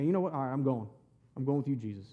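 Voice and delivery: low, soft acted decision; quiet resolve. Peter deciding to follow Jesus, quiet resolve. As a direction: say it low and understated, as if settling it.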